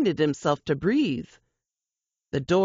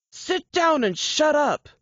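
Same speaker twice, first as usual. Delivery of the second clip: angry shout; 9 semitones higher than usual. Acted. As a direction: scream the order, losing control. Gunman threatening a woman.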